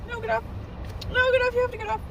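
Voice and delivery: high panicked shrieks; screaming at a spider. Real, not acted. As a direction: shriek get off in real panic.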